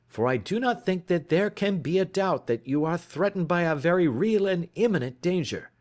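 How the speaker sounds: detective pressing for evidence; Holmes urgent. Acted. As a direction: urge him on, impatient. Holmes's dialogue voice.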